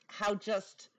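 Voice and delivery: ordinary talk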